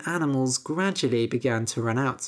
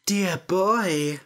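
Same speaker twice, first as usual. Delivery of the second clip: creaky voiced crone greeting; old witch; 3 semitones higher than usual. Witch greeting Jack at the market, witchy voice. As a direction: lure the boy in, witchy.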